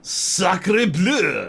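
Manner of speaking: put-on high, loud French chef accent; hammed-up French chef accent. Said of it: "Okay, I'm done".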